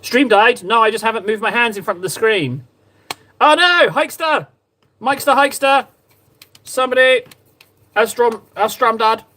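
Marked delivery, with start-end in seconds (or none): 3.4-9.2 calling out. Real, not acted. Stream died, calling for viewers.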